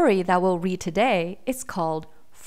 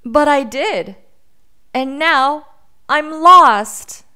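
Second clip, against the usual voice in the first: high, loud wail building to the end; distressed little boy; 7 semitones higher, 11 dB louder, slower than usual. A narrator's reading. Lost boy confesses to the bear he disobeyed, distressed. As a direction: lament it as a lost child, growing louder.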